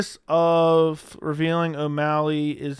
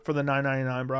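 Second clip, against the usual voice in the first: usual speaking voice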